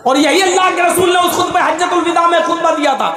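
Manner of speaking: full preaching. Acted. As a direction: proclaim it from the pulpit. Preaching the sermon's point.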